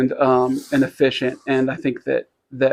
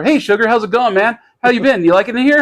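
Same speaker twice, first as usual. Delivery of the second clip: high, upbeat mock greeting; lightly voiced cheerful host welcoming the new guy; a hint of a jovial celebrity; friendly famous guy; 8 semitones higher, 4 dB louder, faster than usual. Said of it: "Dave Kindig goes over".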